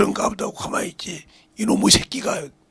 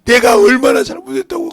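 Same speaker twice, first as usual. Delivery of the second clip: loud, high protest to God; acted reproachful outburst; resentful complaint; 8 semitones higher, 13 dB louder than usual. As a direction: imitate an aggrieved believer crying out. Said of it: "또 원망을 해".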